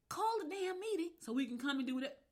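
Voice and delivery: high, pushy retold demand; a mild mock yell of a bossy demand; mock demanding shout. Retold quarrel, other side voiced.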